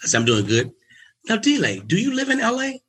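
suddenly all friendly; eager friendly agent. Retold or imitated. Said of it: "Tone is a lot different".

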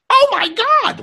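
very high, loud fangirl squeal; mock shriek in piercing falsetto; starstruck ex-wife. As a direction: imitate a woman shrieking at a celebrity. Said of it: "turns around and goes".

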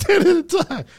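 slow, high, laughing repeat. Real, not acted. Friend cracking up over agencies not talking. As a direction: chuckle the words out. Laughs at CIA versus FBI.

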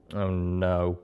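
ordinary delivery

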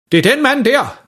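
high, accusing mother; anklagende mor. A narrator's reading. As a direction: point him out in a high voice. Mor udpeger faren for vagterne. (højt og anklagende)

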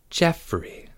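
soft, measured name; hushed, deliberate warning; slow. A narrator's reading. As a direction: say it slowly and carefully. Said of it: "said Charlie slowly".

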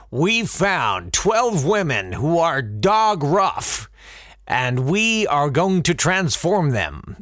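slow, drawn-out mock announcer; mock producer voice. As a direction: sneer it like a smug TV host. Mocking the TV show producers.

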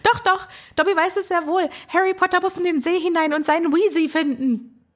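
high, insistent house-elf voice; Dobby. Retold or imitated. House-elf contradicts Harry, high and insistent. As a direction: pipe up as an eager little elf. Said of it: "weil Harry sagt dann".

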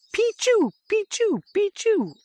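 very high, squeaky falling chirps; tiny mechanical two-note bird call; coal tit call imitation. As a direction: mimic a coal tit far above speaking pitch. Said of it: "higher pitch".

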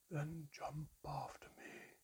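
slow, faint high whisper; frightened, barely audible plea; fearful whisper. A narrator's reading. The shrunken mermaid whispering her fearful instructions, slow and faint. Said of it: "Her voice was filled with fear".

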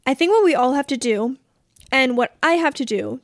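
ordinary delivery

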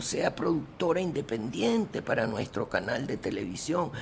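overjoyed producer praising her film; enthusiastic German producer. Retold or imitated. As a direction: gush like the thrilled TV boss. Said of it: "El tipo salió tan entusiasmado, tan feliz".

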